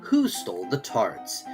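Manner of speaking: plain reading voice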